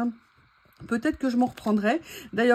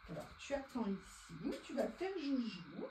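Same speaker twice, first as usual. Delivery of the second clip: much softer, hushed to pet; quiet; 17 dB quieter than usual. Real, not acted. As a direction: murmur to settle the pet. Settling the pet aside.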